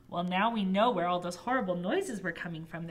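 ordinary delivery, nothing marked

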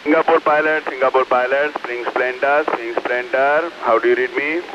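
marine radio. Real, not acted. Ship calls pilot station.

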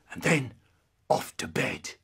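ordinary delivery, nothing marked

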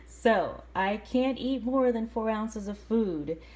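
ordinary talk

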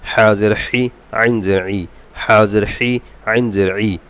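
slow, even drill of each letter with its kasra; Arabic letter drill. Shown as a demonstration. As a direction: recite each letter like a Quran teacher. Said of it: "এভাবে মশক করবেন". (আরবি হরফ মশকের উচ্চারণ)

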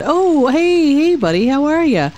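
high, bright overfriendly hey buddy; butter up the stranger; fake friendly greeting. Real, not acted. Player greeting a stranger, high fake friendliness.

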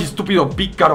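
ordinary talk, nothing marked

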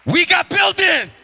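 high, loud jubilant yell; hammed-up triumphant holler; excited mock shout. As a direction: shout the news in mimicked broken English. Echoing the Korean owner, elated.

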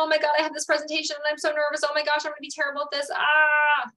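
high, rushed nervous babble; mock panic. Shown as a demonstration. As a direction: rattle off the worries at x2 speed. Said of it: "I'm not freaking out".

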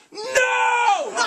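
long, high drawn-out scream of protest; horrified yell. Retold or imitated. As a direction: re-enact leaping up in horror with a huge yell. Larry David jumps up, objecting.

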